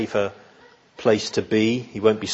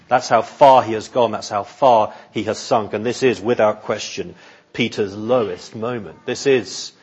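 ordinary talk, nothing marked

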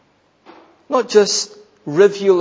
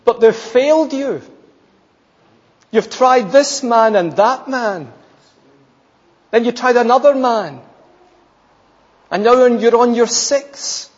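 higher, louder pulpit appeal; impassioned preaching; 5 semitones higher, 3 dB louder than usual. Acted. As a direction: press the point home to the congregation.

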